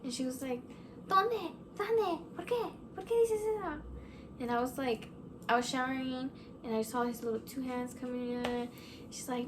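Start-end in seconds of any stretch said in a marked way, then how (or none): 1.1-3.8 high-pitched rapid-fire questions, imitating mom; startled mom. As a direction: mimic a spooked mother, each question swooping down from high. Mom's startled reply to ghost report.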